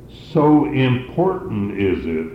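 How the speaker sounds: ordinary talk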